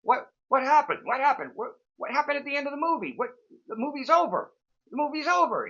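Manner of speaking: high, loud, exasperated sputtering; retold fuming dad, high and loud; imitating angry father. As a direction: mimic a dad blowing a gasket over the missed ending. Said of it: "My father blew a gasket".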